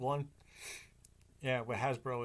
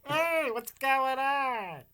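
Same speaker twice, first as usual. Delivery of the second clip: voiced girl; 10 semitones higher, much slower than usual. Acting a girl with big bow.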